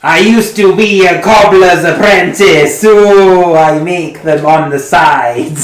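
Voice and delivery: loud, high and slow; proud bard voice. Acted. Bard character explaining, loud slow.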